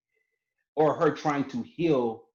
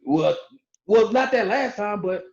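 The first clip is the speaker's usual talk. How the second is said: defensive backpedal; 8 semitones higher than usual. Retold or imitated. Acted backpedal in couple's spat.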